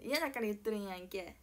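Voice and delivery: 嫌だから言っとるんやんけ with a laughing fake Kansai やんけ; fake Kansai dialect. Shown as a demonstration. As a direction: toss off 言っとるんやんけ in mock Kansai, half laughing. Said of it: "エセ関西弁なまりが入ってきて".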